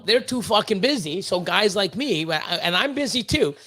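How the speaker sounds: normal talking voice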